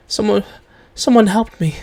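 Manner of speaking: high, halting confession; shocked. Acted. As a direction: falter and restart, taken aback. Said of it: "she's shocked that you would ask".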